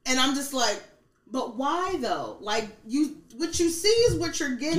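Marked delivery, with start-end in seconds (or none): 1.3-4.8 retelling a frustrated comeback; frustrated retort. Retold or imitated. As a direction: push back in mild frustration. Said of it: "it gets frustrating".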